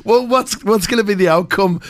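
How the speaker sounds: usual speaking voice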